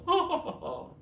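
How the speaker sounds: high little trill of a laugh; tinkling laugh. Acted. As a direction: laugh daintily. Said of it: "a little tinkling laugh".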